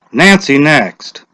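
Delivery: a hint of a village official calling out; lightly voiced lottery official; Mr. Summers' voice. A narrator's reading. Mr. Summers calls names.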